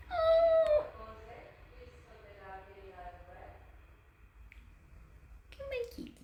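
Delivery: very high coaxing coo. Real, not acted. Cat sitter coaxing a kitty out, high baby talk. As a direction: coax it in a tiny high voice.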